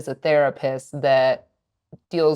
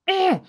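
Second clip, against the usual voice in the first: mock toddler grunt. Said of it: "he went".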